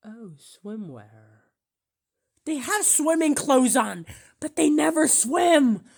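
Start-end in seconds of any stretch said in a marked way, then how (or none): 2.5-5.8 loud, high, amused disbelief; excited outburst. Real, not acted. Reacting to Wall-E swimmers, loud and high. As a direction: blurt it out, cracking up at the joke.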